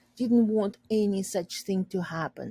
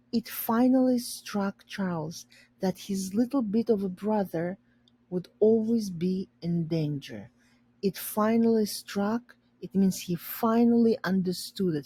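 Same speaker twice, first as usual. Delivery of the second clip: ordinary delivery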